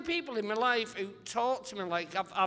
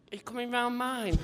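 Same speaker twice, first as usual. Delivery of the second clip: put-on kid asking to play; 3 semitones higher than usual. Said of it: "like a kid asking someone if they're playing out".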